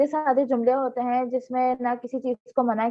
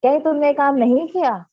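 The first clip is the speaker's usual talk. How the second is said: louder surprised question, falling at the end; astonished question; 3 semitones higher, 6 dB louder than usual. Shown as a demonstration. Teacher demonstrates a negative question to students, astonished. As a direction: ask in mild disbelief, voice raised. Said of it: "उसमें हम हैरानी का भी इजहार करें".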